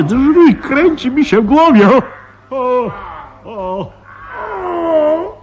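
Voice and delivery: terrified, voice going up as he faints; panicked. Acted. Commissioner sees the mutant chewing the door, panicked moaning.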